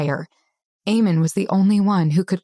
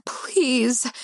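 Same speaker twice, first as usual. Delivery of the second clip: breathy plea; 5 semitones higher, 4 dB quieter than usual. Acted. Begging, whimpering.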